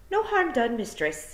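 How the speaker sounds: high Scots wee-man apology; smooth it over quickly; Scottish pictsie voice. A narrator's reading. Wee man apologising to Tiffany, high Scots. Feegle placating.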